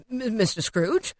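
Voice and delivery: slow, stammered question; startled gentleman. A narrator's reading. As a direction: hesitate in mild disbelief. Startled gentleman recognises Scrooge.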